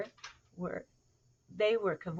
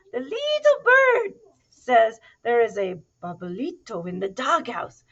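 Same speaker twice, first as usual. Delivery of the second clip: put-on loud, slow Spanish-accented announcement; mock Spanish accent; 4 semitones higher, 10 dB louder than usual. A narrator's reading. Kitten reporting the bird's news, mock Spanish accent. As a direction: proclaim it in a playful Spanish accent.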